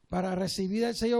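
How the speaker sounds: normal talking voice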